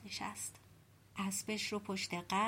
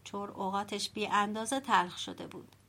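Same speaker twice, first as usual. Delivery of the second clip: ordinary delivery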